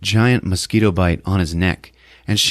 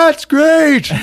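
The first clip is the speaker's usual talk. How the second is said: high, loud put-on cry; mock exclamation; 5 dB louder than usual. Recap voice, acted loud exclamation.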